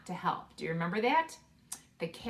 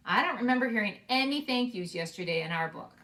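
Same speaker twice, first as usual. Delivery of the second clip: ordinary delivery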